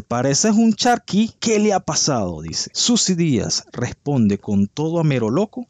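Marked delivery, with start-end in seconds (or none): 0.0-2.4 Pareces un charqui pitched much higher and slowed, camp mockery; mock catty insult. Quoting a celebrity's jab at a rival, hammed-up mocking. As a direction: sneer it in a high, teasing voice. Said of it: "Le dice".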